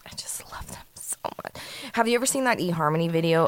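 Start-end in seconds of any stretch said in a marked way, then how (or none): 0.1-1.6 overcome, whispered gushing; whispered confession. Pretend. Cat lover gushing to her co-host, whispered. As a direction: whisper it as if too moved to speak. Mock-tearful confession of loving cats.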